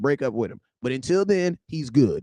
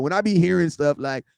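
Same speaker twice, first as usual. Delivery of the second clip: ordinary talk, nothing marked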